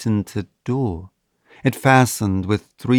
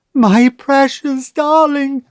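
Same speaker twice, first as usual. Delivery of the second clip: much higher, loud, slow wail; wailing mother; 14 semitones higher, 5 dB louder than usual. A narrator's reading. Mother wailing for her daughter locked in the vault. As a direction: wail in terror for the trapped child. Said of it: "wailed the mother".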